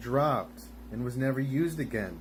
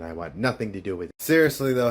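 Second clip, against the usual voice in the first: normal talking voice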